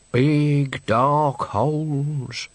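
very slow, dazed worker; Mister Pounder's voice. A narrator's reading. Ratcatcher to the director, very slow and dazed. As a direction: drag the words out in a daze.

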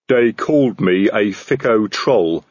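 slow, dark troll rumble. A narrator's reading. Troll sergeant tells his commander of his mistreatment, darkly, slowly. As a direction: sulk it out like a wronged troll. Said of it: "said detritus darkly".

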